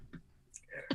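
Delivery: whispered, mock banishment; breathy whispered get out. Real, not acted. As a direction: hiss it out jokingly.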